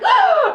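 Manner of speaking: big high sharp mock gasp; dramatic gasp. Reacting to the slap in the film with a dramatic gasp. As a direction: gasp like a soap audience.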